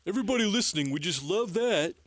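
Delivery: cartoon character addressing listeners; vintage cartoon voice. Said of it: "just like this".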